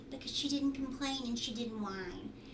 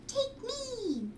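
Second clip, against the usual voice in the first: much higher, little egg voice; plain eggs calling; 12 semitones higher than usual. A narrator's reading. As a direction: squeak it like a tiny egg begging. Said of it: "all the plain eggs said".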